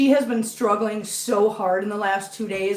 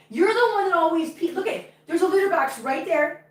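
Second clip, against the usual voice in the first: scolding pet talk; 7 semitones higher than usual. Real, not acted. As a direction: point out the mistake to a pet, high-voiced. Scolding the cat about peeing.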